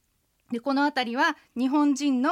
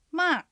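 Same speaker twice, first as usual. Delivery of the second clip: ま falling sharply from high; fourth tone; 5 semitones higher than usual. Shown as a demonstration. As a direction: throw ま down from the top. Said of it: "続けて四つ発音しましょう". (ま を高くから一気に下げる第四声)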